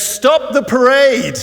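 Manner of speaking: loud, high, drawn-out order; commanding call. Acted. Aladdin halts the parade from atop an elephant, commanding call. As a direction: call a halt across the whole crowd. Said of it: "looks down and says".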